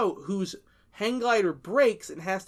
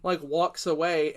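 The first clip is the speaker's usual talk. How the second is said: ordinary delivery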